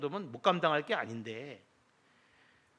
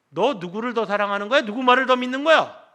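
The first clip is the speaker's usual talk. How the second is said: loud, rising challenge from God; stern divine rebuke; 7 semitones higher, 12 dB louder than usual. Retold or imitated. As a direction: thunder the question like a rebuking father. (크게 꾸짖는 하나님의 음성)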